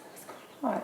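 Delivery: hushed, breathy whisper to her mom; whispered question. Retold or imitated. Asking her mom in the doctor's office, whispered. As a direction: whisper it so the caller can't hear. Phone to ear, asks mom quietly.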